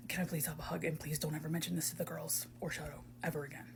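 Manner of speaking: very soft, low whispered plea; embarrassed whisper. Acted. Asking a friend for a secret hug, embarrassed whisper. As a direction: whisper it, small and private.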